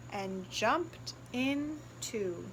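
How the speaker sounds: slow and soft; slow soft ending as the father jumps in; drawn-out ending. A narrator's reading. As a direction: stretch the line out. Slow read-aloud climax.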